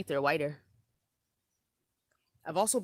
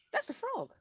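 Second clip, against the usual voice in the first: excited exclaim. Real, not acted. Recognises the frog sound.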